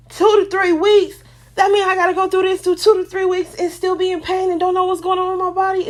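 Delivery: high, fast, frantic disbelief; panicked inner voice. Retold or imitated. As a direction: blurt it out in rising panic.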